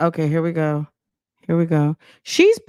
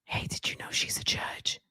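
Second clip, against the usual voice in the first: unvoiced conspiratorial whisper; 17 dB quieter, faster than usual. Real, not acted. Commenter sharing gossip with viewers, whispered. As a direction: lean in and whisper the secret.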